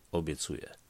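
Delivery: ordinary delivery, nothing marked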